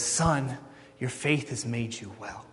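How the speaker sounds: soft, low, gentle pronouncement; tender reassurance. Acted. Preacher as Jesus telling the blind man he is healed, tenderly. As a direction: bestow healing quietly, voice dropped low.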